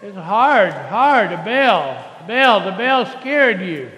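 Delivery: loud, slow soothing over noise; loud reassurance. Real, not acted. Soothing child over loud bell.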